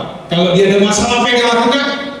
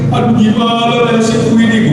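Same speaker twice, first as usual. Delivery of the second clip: mock self-pitying wail. Preacher showing the wrong kind of crying, mock wailing over one's fate. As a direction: imitate a mildly self-pitying cry. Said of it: "Nangis bukan nangis".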